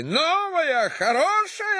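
very high, thin outburst; thin-voiced cry. A narrator's reading. Ruined man echoes his chauffeur, crying out in a thin high voice. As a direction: throw the words back in a piercing high cry. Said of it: "закричал тонким голосом".

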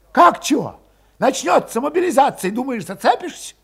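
high, loud impatient retort; Valet voiced high. A narrator's reading. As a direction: scoff at their doubts.

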